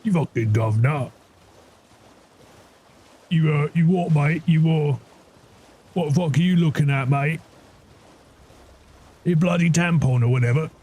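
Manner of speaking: mock British thug picking a fight in a London accent; playful British geezer taunt. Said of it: "Isn't Patches also British?".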